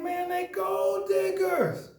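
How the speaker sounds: drawn-out, pointed gold diggers; telling his boy slowly and firmly; slow emphatic. Retold or imitated. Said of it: "I'm like".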